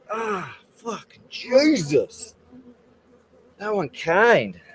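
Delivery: slow, high, pained; pained groan. Real, not acted. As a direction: drag it out through the pain. Sting still hurting.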